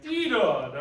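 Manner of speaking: "tita" hit far too hard and loud; overdone accent. Shown as a demonstration. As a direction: bang out "tita" with a heavy accent. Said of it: "So it's not gonna be like".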